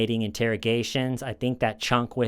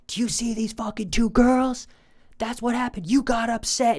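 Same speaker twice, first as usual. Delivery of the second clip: high, strained interrogator's yell; imitated screaming; 12 semitones higher, 3 dB louder than usual. Interrogator confronts suspect about the two girls, screaming. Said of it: "he's screaming".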